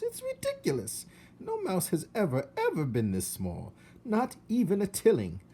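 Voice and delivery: high, scandalized aunt voice; shrill up with outrage on each ever; Aunt Florence scandalized. Acted. Aunt scorning the tiny mouse, high and scandalized. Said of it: "said his Aunt Florence".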